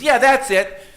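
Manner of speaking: put-on high, loud delighted kid; boy thrilled his parents finally understand; excited child. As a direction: burst out like a child who got it right. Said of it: "He says".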